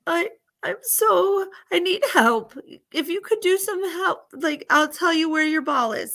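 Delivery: put-on high, halting old-lady voice; needy old lady. Old lady asks the visiting girl for help, high and needy. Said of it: "the old lady says".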